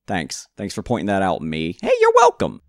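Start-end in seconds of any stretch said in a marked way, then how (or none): 1.8-2.6 loud, very high silly voice; put-on self-reply. Player answering his own thanks, loud high-pitched mock voice. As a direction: squeal it back as a cheery alter ego.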